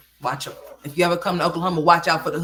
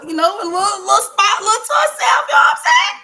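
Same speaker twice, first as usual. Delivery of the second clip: high, loud laughing shout; laughing squeal; 8 dB louder than usual. Real, not acted.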